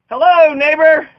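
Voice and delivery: a hammed-up booming neighborly hello; mock hearty greeting. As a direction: call Hello, neighbor out high and slow. Playful greeting demo.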